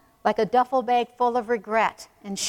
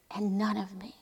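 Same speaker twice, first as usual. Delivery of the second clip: whisper; 9 dB quieter than usual. Real, not acted. Quiet personal devotional aside.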